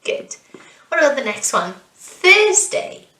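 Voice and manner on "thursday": "Thursday" with THURS high and loud; first-syllable stress. Shown as a demonstration. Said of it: "the stress is on the first syllable".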